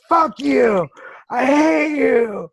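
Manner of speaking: loud, high, slow screaming through tears; furious crying shout. Retold or imitated. To her husband, begging to stop the showers, screaming in tears. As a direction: scream it out, at the end of your rope. Retold breakdown yelling at husband.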